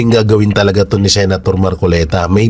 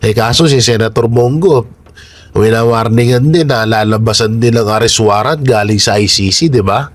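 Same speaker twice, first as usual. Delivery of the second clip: ordinary delivery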